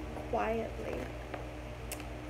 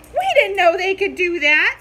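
startled kittens; 4 semitones higher, 8 dB louder, faster than usual. A narrator's reading.

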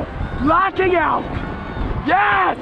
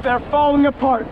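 normal talking voice

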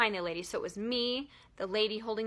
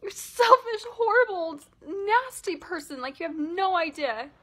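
much higher and much louder, hurling insults; furious imagined yell; 8 semitones higher, 7 dB louder than usual. Pretend. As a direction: let all the pent-up shame burst out at her. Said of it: "and yelling".

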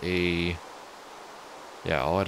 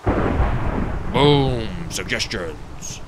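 booming announcer; 6 dB louder, much slower than usual. Pretend. Mock announcer punchline.

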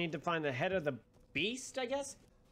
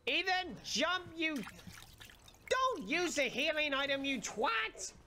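exasperated yelling; 9 semitones higher, slower than usual. Real, not acted. Yelling at game character.

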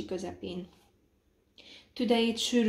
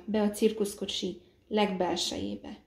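plain reading voice

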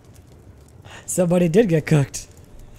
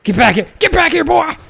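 louder and higher, hollering after him; chasing yell; 12 semitones higher, 9 dB louder than usual. Real, not acted. Streamer chasing a fleeing player, hollering. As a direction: call him back as he runs.

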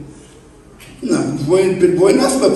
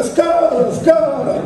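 slow, higher drawn-out yell with a laugh; shouting; 7 semitones higher, much slower than usual. Retold or imitated. Painter shouts for the ladder on waking, drawn-out. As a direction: yell for the ladder in your sleep.

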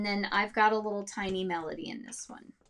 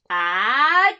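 shouted sneeze; 2 semitones higher, 12 dB louder than usual. Shown as a demonstration. Teacher demoing the big sneeze in all caps. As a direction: sneeze it out at full volume. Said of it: "that is our shouting voice".

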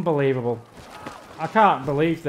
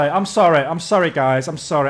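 normal talking voice

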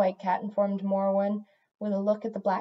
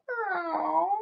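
high, plaintive cat cry; complaining meow; 9 semitones higher than usual. A narrator's reading.

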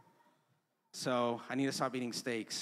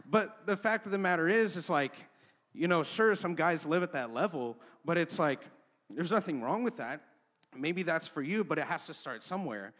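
preaching at full force; 3 semitones higher, 6 dB louder than usual. Acted. Pastor pressing the congregation, loud preaching. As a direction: preach it hard to the crowd.

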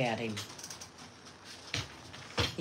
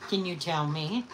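ordinary talk, nothing marked

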